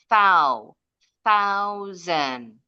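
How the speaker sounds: THOU alone, then THOUSAND with th and z; th and z sounds. Shown as a demonstration. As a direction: build THOUSAND from THOU, tongue tip out. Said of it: "Bunyi S-nya seperti Z". (THOUSAND, th jelas dan s berbunyi z)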